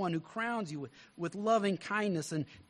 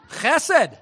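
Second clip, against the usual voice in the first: laughing; 8 semitones higher, 14 dB louder than usual. Real, not acted. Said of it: "laughing at own joke".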